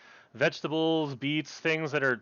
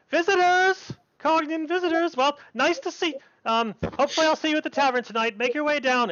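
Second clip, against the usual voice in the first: high, excited-sounding herald of visitors; high-pitched villager calling to newcomers; townswoman calling out; 12 semitones higher than usual. Acted. Townswoman pounds on a door and announces visitors, high calling voice.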